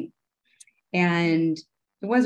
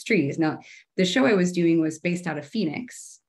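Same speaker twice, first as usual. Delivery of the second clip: ordinary delivery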